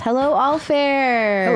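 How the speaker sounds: slow sing-song hello; drawn-out greeting. Pretend. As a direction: stretch the hello out warmly. Greeting author playfully.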